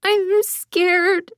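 put-on high, small frightened child's whimper; a creature faking a scared child. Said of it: "it whimpered".

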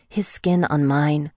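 ordinary delivery, nothing marked

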